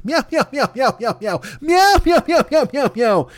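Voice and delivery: high squeaky meowing laugh; cat laughing. Pretend. The fat cat laughing as he rolls it, high meows. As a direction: meow it out like a giggling cat. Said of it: "he'll laugh".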